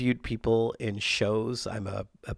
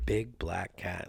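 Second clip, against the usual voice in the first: usual speaking voice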